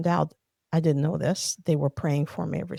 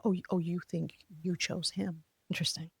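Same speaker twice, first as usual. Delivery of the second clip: wry pastor; 8 dB quieter than usual. Retold or imitated. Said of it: "And he'd be like".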